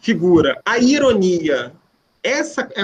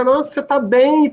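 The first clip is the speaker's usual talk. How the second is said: much higher, honeyed fake praise; mock compliment; 7 semitones higher than usual. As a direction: hide an insult in a sweet compliment. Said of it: "Vocês já ouviram isso". (elogio forçado, mais agudo)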